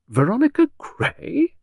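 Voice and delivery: high, slow, astonished echo; astonished, voice lifting high; pure astonishment. A narrator's reading. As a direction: repeat the name as an amazed question. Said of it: "pure astonishment in Beryl's voice".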